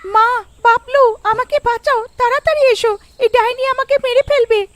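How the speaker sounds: put-on girl shrieking as the witch grabs her; terrified scream for help. (আতঙ্কে প্রাণ বাঁচানোর চিৎকার)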